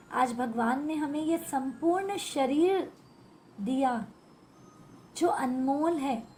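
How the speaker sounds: normal talking voice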